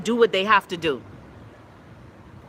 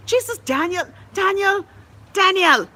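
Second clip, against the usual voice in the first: high, rising cry of his name, louder each time; exasperated calling out; 9 semitones higher, 3 dB louder than usual. Real, not acted. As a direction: call out to Daniel in disbelief, higher and higher.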